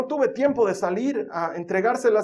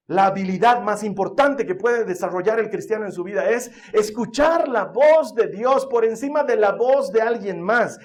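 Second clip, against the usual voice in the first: louder, rising preacher's build; preaching; 3 semitones higher, 4 dB louder than usual. Acted. Pastor urging the church to hear God first, full preaching voice. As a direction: hammer the point home to the congregation.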